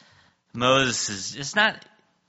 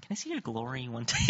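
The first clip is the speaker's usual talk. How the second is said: much softer, hushed request; a timid hushed favor; soft asking; 13 dB quieter, faster than usual. Retold or imitated. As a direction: ask gently, almost whispering. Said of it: "Does it seem like he whispered it?".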